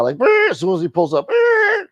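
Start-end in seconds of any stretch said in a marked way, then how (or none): 0.2-0.5 loud, very high-pitched honk; imitated car horn. Driver honking at the man by his car, loud horn noise. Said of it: "he honks, like, mad loud". 1.3-1.9 loud, high, sustained honk; imitated car horn. SUV pulling up beside the smoker, blaring honk noise. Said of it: "he honks, like, mad loud".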